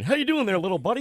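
high, loud, hearty big-buddy voice; hammed-up jolly captain greeting; Skipper voice. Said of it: "he looked like the skipper".

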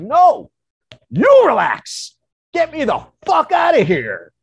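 full-force high, angry refusal; freaking-out shout. Retold or imitated. Telling the shaman to let him out, freaked-out shouting. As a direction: yell back at the one calming you. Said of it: "I'm, like, freaking out".

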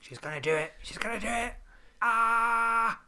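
rising squeals into a loud shriek; impression of excited friend. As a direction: mimic her building panic to a scream. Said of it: "That was my impression of you".

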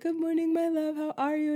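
put-on high-pitched, light take on an African accent; imitated African accent. Old neighbor greeting her on morning walk, imitated African accent. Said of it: "in his little African accent".

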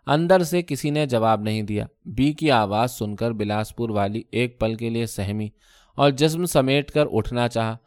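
ordinary delivery, nothing marked

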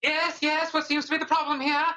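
high, fast, fussy kobold manager. Acted. Blue kobold manager bustling over to the complaint, high and fast. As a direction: pitch it way up and rattle it off. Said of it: "And he says".

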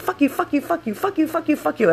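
high rapid-fire retold swearing; mock frantic cursing. Rider pointing at each passenger, high frantic cursing. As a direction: re-enact cursing each of them in turn, high-pitched. Acted repeated cursing at car.

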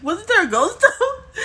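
asking through laughter, rising high; laughing. Real, not acted. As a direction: giggle out the question.

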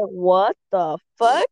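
slow, drawn-out inner reaction; slowly stretched incredulous question; stunned disbelief. Retold or imitated. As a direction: replay a stunned thought, word by word. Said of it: "like, stunned".

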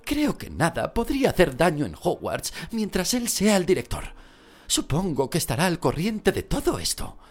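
high anxious mother voice; Molly Weasley voice. Acted. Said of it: "Molly voiced, high and far".

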